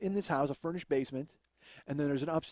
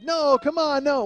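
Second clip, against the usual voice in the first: high, loud pleading no; protesting shout; 12 semitones higher, 10 dB louder, slower than usual. Retold or imitated. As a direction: beg them to stop. Woody resisting friends.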